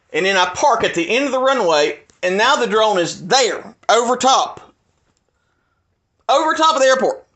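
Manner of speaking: heated explaining. Real, not acted. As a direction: push every point hard.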